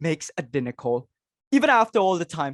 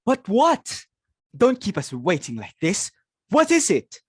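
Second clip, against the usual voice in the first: impatient demand; 4 semitones higher than usual. Acted. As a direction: press her hard for the answer. Mother demands the bad news.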